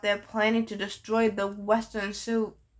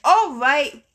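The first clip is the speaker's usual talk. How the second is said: a loud, higher angry shout at the sky; 4 semitones higher, 9 dB louder than usual. A narrator's reading. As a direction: bellow it up at the thunder. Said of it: "Chiron shouted".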